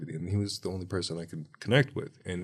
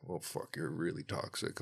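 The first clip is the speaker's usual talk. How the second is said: mock grim remark; 10 dB quieter than usual. Healer's remark retold.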